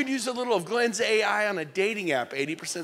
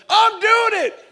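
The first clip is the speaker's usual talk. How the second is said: much higher and louder, defiant; defiant shout; 15 dB louder, slower than usual. Retold or imitated. Agent overriding the inner voice, defiant shout. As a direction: yell it, stubborn. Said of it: "And you're like".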